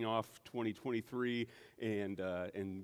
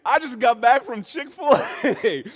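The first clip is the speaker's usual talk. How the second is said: high, loud reply with laughter in it; mock-proud retort, stretched out at the end; comic protest; 13 semitones higher, 15 dB louder, slower than usual. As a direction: play up the sheepish confession for laughs. Comic retold reply to neighbor.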